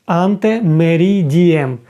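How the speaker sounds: "Ante meridiem" said slowly, syllable by syllable; slow demo. Shown as a demonstration. Teacher slowly models "Ante meridiem" for the class. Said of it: "До полудня".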